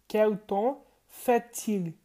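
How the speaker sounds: "Quel temps fait-il" said slowly, syllable by syllable; slow French model. Shown as a demonstration. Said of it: "esse T no final se junta com I". ("Quel temps fait-il" bem devagar, pausado)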